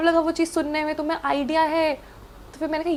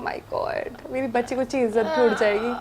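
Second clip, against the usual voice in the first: hushed; 3 semitones lower, 7 dB quieter than usual. Retold or imitated. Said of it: "मैंने कहा".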